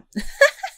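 high burst of laughter through tears; teary laugh. Real, not acted. Fan wiping tears, high teary laugh. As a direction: laugh at yourself for crying.

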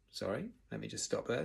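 ordinary delivery, nothing marked

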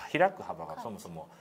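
ordinary talk

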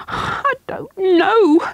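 put-on high, slow tearful boy; crying. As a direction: break into tears on the words. Terrified boy near tears.

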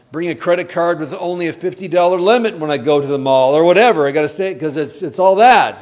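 hammed-up panicky vow, fast and loud; mock frantic resolve. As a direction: ham up a frantic promise to yourself. Mocking our own resolutions.

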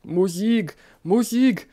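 high, loud desperate scream. A narrator's reading. Dying emperor screams for music. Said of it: "schrie der Kaiser".

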